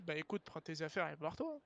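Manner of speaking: ordinary talk, nothing marked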